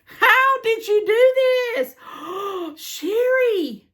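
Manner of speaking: high, gasping squeals of amazement; real squeal. Real, not acted. To the friend who sent the gift, squealing. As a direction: squeal it slowly, gasping before the name. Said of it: "now that I've squealed".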